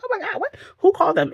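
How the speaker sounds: high, squeaky shocked gasp of a question. Retold or imitated. Reacting to paparazzi at the house, squealing in shock. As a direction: jump way up in pitch, stunned.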